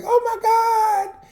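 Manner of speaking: high, slow, drawn-out gasp of delight; queening out. Retold or imitated. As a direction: gush over seeing an old friend. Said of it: "I just, just queened out".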